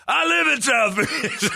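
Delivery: high laughing impression of the driver; mock gun guy. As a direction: laugh through the offended reply. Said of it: "And then he goes".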